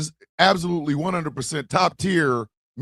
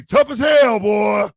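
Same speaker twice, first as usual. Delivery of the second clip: mock tough shout; 5 semitones higher, 6 dB louder, slower than usual. Mocking Bernie's tough talk.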